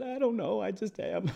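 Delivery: high, mock-helpless reply; lightly hammed-up helplessness, laugh after; imitated tearful cousin. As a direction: pitch it way up as the upset cousin. Imitates the crying cousin's answer.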